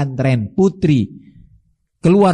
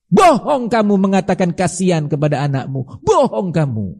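indignant rebuke; 3 semitones higher than usual. Acted. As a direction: denounce them, pushing the pitch up. Preacher denounces parents as cruel liars.

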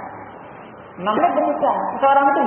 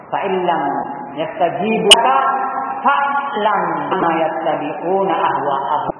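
slow, lower recited Arabic verse; Quran recitation; 4 semitones lower, slower than usual. Acted. Preacher citing Surah Al-Qasas to the congregation, slow solemn recitation. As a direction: draw the verse out slowly, lower than speech.

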